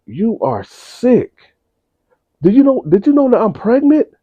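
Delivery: mock a disgusted mother; outraged mother. Mother scolding son.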